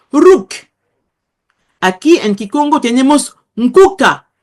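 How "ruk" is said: Ruk clipped to one syllable, hard k; Ruk without the patah. Shown as a demonstration. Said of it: "se podría pronunciar". (Ruk sin la a)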